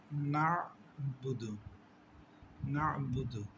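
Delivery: না'বুদু recited slowly and carefully; recited demo. Shown as a demonstration. Teacher reciting the word for students. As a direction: model না'বুদু syllable by syllable. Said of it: "তাড়াতাড়ি উচ্চারণ করতে হবে".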